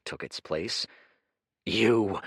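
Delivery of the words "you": growled. Acted. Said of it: "he growled".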